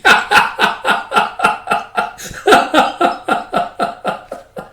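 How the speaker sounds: long, high-pitched staccato laugh; drawn-out acted cackle. Laugh at end of acted speech.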